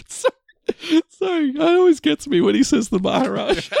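apologizing through high laughter; can barely get the words out for laughing; laughing while talking. Real, not acted. Friend's Maharaja impression has him laughing through an apology.

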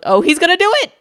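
high, loud gasp of realization; alarmed, excited realization. Retold or imitated. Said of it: "And I'm like".

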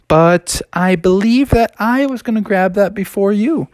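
a hint of a nervous, polite cub. Bubby addresses the woodpecker, nervously polite.

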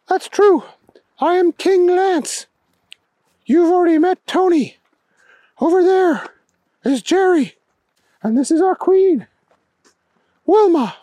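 high-pitched royal introductions; alien king, formal. A narrator's reading. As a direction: present your people as a high-voiced alien. Alien king introduces his people.

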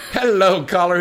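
ordinary talk

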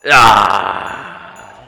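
loud startled yelp; sudden loud cry of surprise. Real, not acted.

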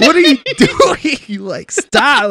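high, drawn-out, loud squeal; exasperated mock plea. Viewer begging livestreamer to quit, mock exasperation. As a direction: stretch it out in comic disbelief.

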